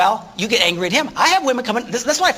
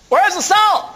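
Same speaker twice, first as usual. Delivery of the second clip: clueless husband; 9 semitones higher, 4 dB louder, slower than usual. Retold or imitated. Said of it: "a man can open a kitchen cupboard and go".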